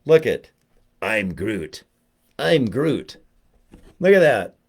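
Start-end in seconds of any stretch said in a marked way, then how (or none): 1.0-1.8 low toy-character voice; Groot. Pretend. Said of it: "I'm now gonna show you Groot". 2.4-3.2 lightly voiced toy character; Groot. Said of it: "I'm now gonna show you Groot".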